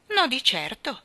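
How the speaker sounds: firm, decided answer; resolute. Acted. Little brother answering Jane, firmly resolute. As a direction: say it flatly certain. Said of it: "egli rispose risolutamente".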